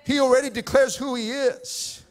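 normal talking voice

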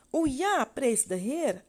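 put-on a happy girl praising God; joyful child. A narrator's reading. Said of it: "antwoordde het kind". (blij uitroepend kind, veel hoger)

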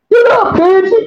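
a hammed-up shout up high; mock yell. Mother yells at daughter to get up, mock shout. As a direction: yell it like a mom waking her kid.

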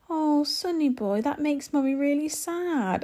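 put-on gently guilt-trip a toddler, drawn out and sorrowful; put-on sad. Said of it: "and said it like that".

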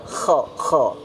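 short scraped Kho, voiceless; correct kho. Shown as a demonstration. Teacher drilling the letter, dry scraped kh in kho. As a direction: rasp kho in the upper throat. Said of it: "tapi kho ya".

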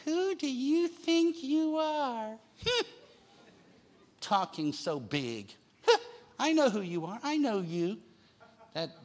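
lightly voiced mocking family member; belittling relative. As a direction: scoff at him like a relative who knows better. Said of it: "have said similar things".

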